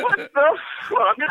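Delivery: high, drawn-out shriek of disbelief, half laughing; incredulous outburst. Retold or imitated. Client recounting his shock at the masseuse, incredulous outburst.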